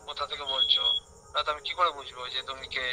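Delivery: ordinary delivery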